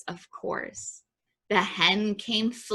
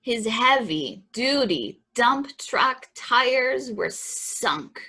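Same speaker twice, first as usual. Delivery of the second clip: ordinary delivery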